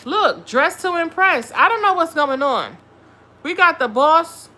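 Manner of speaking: excited reveal. Real, not acted. Reading Marino's cards to viewers, excited. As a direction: announce each card with a gasp of glee.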